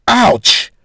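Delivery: a sharp, loud, high yelp of pain; mock hurt cry; pained groan. Aloysia as the wrap is tightened, loud yelp. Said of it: "Aloysia groaned".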